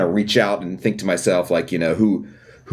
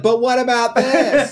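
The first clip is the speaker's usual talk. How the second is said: high, slow, laughing objection; mock objection; 4 dB louder, slower than usual. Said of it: "They're like".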